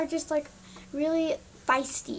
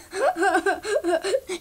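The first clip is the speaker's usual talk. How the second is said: mock giggle; 4 semitones higher than usual. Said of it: "and they're like".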